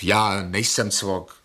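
slow and deliberate, leaning on "já nejsem cvok"; slow insistence. Acted. Patient insisting to the doctor he is sane, slow and firm. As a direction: spell it out word by word.

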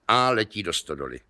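usual speaking voice

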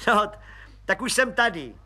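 high, eager voice of a cheeky little policeman; high cheeky detective voice; grinning Pištoras. A narrator's reading. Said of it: "zubil se pan Pištoras".

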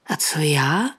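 faintly voiced, rising question; faint utterance. A narrator's reading. Woman asking Petr about herself, faintly. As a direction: let the question out weakly.

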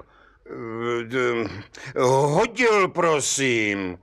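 hesitant, stuttered confession; stammering. A narrator's reading. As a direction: stumble into the word, then trail down. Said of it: "koktal mladý cihlář".

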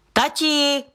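high shout of Dad into the house; a girl yelling for her father, pitched far up; shouting indoors; calling out. A narrator's reading. Daughter calls father indoors.

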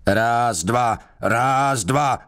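high, chanted drill count; roared marching count. A narrator's reading. Guard forces prisoner to walk round the cell, roared count. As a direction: count the steps out as a yelled command. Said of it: "řval na něho".

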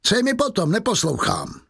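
high-pitched, impatient refusal; brusque dismissal. A narrator's reading. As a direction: wave them off with a pitch jump. Javert brusquely refuses to listen.

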